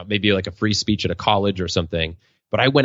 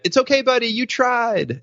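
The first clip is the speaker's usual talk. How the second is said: much higher, sing-song comfort to a little son; consoling dad. Retold or imitated. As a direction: soothe him like a kindly dad, a bit hammed-up. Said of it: "I just went".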